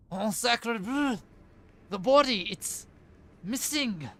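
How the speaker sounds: hammy French chef crying out in alarm. Acted. Caller voiced on the phone.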